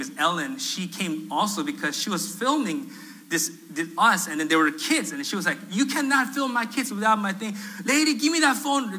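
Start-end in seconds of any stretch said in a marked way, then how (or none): 5.7-8.9 high-pitched retold demand; heated mock protest, pitched up; angry. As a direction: imitate an angry mother confronting someone. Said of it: "just angry".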